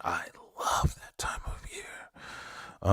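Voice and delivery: slow, soft near-whisper; drawn-out whispered delight; hushed relish. Real, not acted. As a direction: savour it, fading into a slow whisper. Savouring his favourite season.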